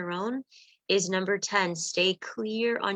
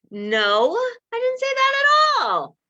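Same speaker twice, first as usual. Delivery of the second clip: high, loud indignant snap; a mock offended partner snapping back, much higher and louder; angry retort; 7 dB louder, slower than usual. Acted angry reply in a quarrel.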